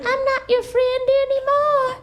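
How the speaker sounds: very high squeaky cartoon voice; talking cigarette. Cigarette rejects the child, very high squeak. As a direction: play a tiny cigarette cutting off a friend. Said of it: "the cigarette would be like".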